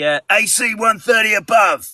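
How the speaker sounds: high put-on otter voice; squeaky otter character voice. Shown as a demonstration. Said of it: "That was my Otter voice".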